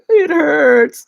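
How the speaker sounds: high, loud mock wail of pain; loud pained cry, hammed up; pained whine. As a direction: whine it out in exaggerated agony. Mock pained complaint two days later.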